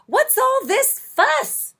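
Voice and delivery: very high, slow fussing voice; owl mama. A narrator's reading. Owl mama to her babies, very high and slow. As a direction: tease the babies fondly. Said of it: "said the owl mama".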